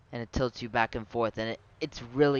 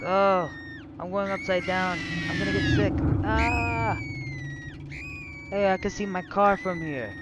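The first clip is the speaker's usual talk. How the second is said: on a roller coaster; 6 semitones higher, much slower than usual. Real, not acted. Riding the spinning ride.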